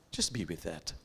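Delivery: quiet reflective instruction; gentle guiding prompt. Acted. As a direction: ease listeners into stillness. Leads a reflective pause.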